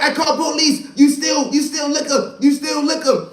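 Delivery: high, loud accusing store clerk; angry counter man. Retold or imitated. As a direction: call them out like a furious clerk.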